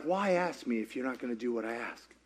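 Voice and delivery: low and soft, wry; dry soft God voice. Retold or imitated. God asking the preacher why he asked, low and soft. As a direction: let God answer deadpan and quiet. God's reply.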